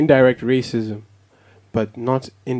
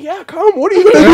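mom challenging; 12 dB louder than usual. Retold or imitated. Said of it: "Mom was like".

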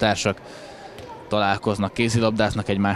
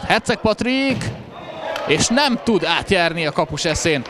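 rising to a thrilled shout; excited sports commentary; 8 semitones higher than usual. Real, not acted. Live handball commentary, shot. (izgatott sportkommentár)

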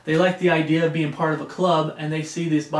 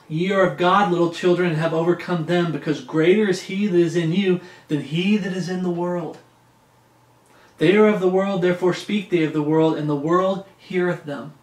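raised, measured Bible reading; scripture reading; 4 dB louder than usual. Acted. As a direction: read it out like preaching from the pulpit. Reads First John aloud.

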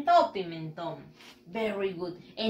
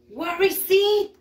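playful teasing question; 6 semitones higher, 5 dB louder, slower than usual. Acted. Teacher teasing kids with a guess.

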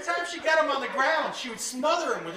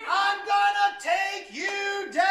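higher drawn-out mock yell, slow; mock battle cry; 8 semitones higher, much slower than usual. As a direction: stretch the war cry out. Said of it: "screams at the top of his lungs".

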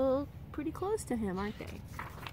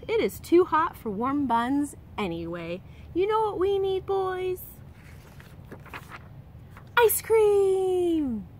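higher and louder, playful mum; cheery mother; 8 semitones higher, 4 dB louder than usual. Acted. Mrs Lambchop to the boys, higher cheerful mum voice. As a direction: announce a treat to the boys.

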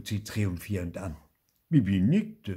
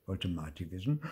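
ordinary delivery

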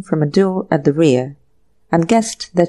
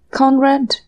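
understated wail, higher in pitch; mild wail; 6 semitones higher than usual. A narrator's reading. Girl calls to the man being arrested, mild wail. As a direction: call his name in mild, narrated anguish. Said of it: "wailed the girl".

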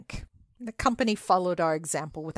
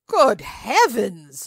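slow, loud cry of shock; host recoiling in loud astonishment; startled exclamation; 3 semitones higher, 8 dB louder, much slower than usual. Acted. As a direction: gasp it out, drawn back in disbelief. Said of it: "ejaculated my host".

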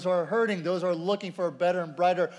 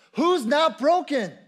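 slow, higher, louder rhetorical question; emphatic question; 8 semitones higher, 6 dB louder than usual. Real, not acted. Preacher asking the congregation who's not broken, emphatic.

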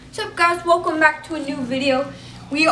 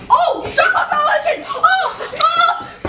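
high laughing yells at the buzzer; excited shouting; 11 semitones higher, slower than usual. Real, not acted. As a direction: shout it out, cracking up. Opponent's shot clock runs out.

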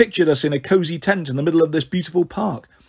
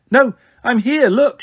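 put-on high, raised playful child voice; giggling child; 7 semitones higher, 5 dB louder than usual. A narrator's reading. Bliss hidden in the tree, high playful giggly voice. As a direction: pipe up gleefully from a hiding place. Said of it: "she said, giggling".

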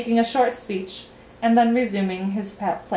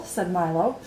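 usual speaking voice

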